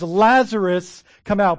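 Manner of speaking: Jesus commanding Lazarus out; loud command. Retold or imitated. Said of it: "And he says".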